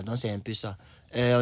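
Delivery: ordinary delivery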